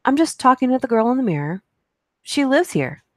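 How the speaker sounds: put-on high, quick child's voice; little girl's voice. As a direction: chirp it like an innocent four-year-old. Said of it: "my four-year-old ... she said".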